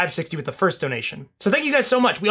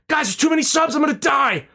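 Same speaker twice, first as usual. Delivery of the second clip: panicked; 8 semitones higher than usual. Retold or imitated. Mock panic about subs.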